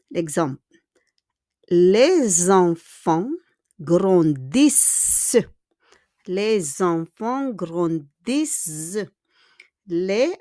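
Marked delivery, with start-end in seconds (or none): 1.7-9.1 "Les enfants" linked as lez-enfants, "grandissent" drawn out; liaison with Z. Shown as a demonstration. Teacher demonstrates liaison to learners, S linked as Z. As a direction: sound out "Les enfants grandissent" slowly, Z linking the first words. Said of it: "it should be pronounced comme un Z".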